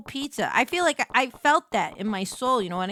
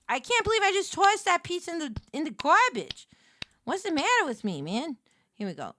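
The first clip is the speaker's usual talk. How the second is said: ordinary talk, nothing marked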